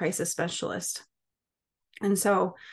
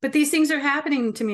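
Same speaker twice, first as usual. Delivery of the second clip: higher, louder, protesting; upset self-quote; 6 semitones higher, 4 dB louder, faster than usual. Retold or imitated. [name] protesting to the evaluators, higher and louder. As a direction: insist it's real. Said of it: "And I'm like".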